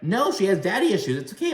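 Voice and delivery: high-pitched mimicked retort; snappy self-justification. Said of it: "Teresa snaps at Jackie".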